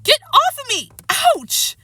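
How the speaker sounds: very high, loud yell; high-pitched frantic protest; crying out in pain. A narrator's reading. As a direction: yell at attackers, yelping in pain. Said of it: "Harrison cried".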